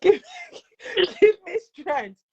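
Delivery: mock prayer for strength; half-laughing mock prayer before presenting; nervous prayer. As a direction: plead to Jesus with a laugh. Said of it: "I'm like".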